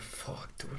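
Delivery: breathed out in a near-silent whisper; stunned whisper. Retold or imitated. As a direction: whisper it like you just saw the damage. Dazed after crashing bumper off.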